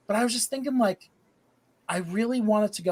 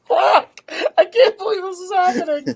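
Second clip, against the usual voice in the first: high, loud cracking-up disbelief; helpless laughing; 12 semitones higher, 6 dB louder than usual. Real, not acted. Storyteller losing the thread to friends, helpless laughing. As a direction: talk through a real giggling fit. Stoned, giggling fit mid-story.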